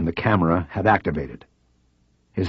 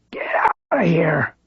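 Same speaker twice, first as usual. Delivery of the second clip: high whispered push from a frail man; frail man's high, whispered order; strongest yet; 10 semitones higher, slower than usual. A narrator's reading. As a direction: force it out on what breath he has. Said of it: "his voice the clearest and strongest".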